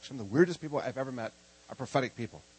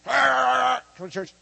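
drawn-out high yell, hammed-up; comic bickering outburst on the way in; loud, exaggerated squabbling noise; mock quarrel; 9 semitones higher, 9 dB louder, much slower than usual. Acts quarrel on way to church.

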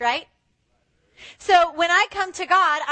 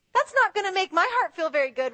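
ordinary talk

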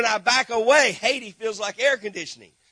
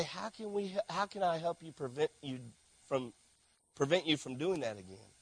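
gentle counsel; 4 semitones lower, 12 dB quieter than usual. Acted.

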